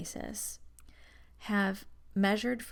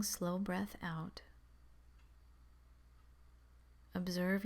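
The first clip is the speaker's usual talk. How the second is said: calm, measured meditation voice with long pauses; guided meditation; 4 dB quieter than usual. Acted. Guiding beginners to focus on the breath, calm meditation voice. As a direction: settle listeners gently, leaving space between instructions.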